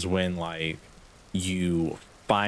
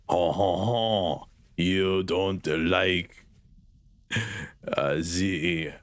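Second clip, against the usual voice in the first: very slow, drawn-out mock French accent; much slower than usual. Streamer mimicking a French rap fan, hammed-up French accent. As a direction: ham up a bad French accent, haltingly. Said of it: "how do I do the, the, the accent".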